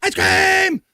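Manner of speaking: put-on high shouted call of a kid; gleeful childish shout; ice cream shout. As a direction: yell it out like a kid hearing the truck. Calling like kids at the truck.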